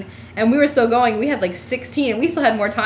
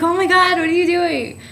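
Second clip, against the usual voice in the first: shocked; 8 semitones higher than usual. Retold or imitated. Pulled onstage by surprise.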